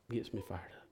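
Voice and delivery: soft intense repeat; hushed fervent echo. Real, not acted.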